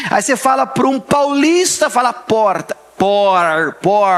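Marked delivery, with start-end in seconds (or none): 3.0-4.1 "Por" with a retroflex r like American; São Paulo r. Retold or imitated. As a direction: mimic a Paulista "Por". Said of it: "é o R de americano". ("Por" com r caipira paulista)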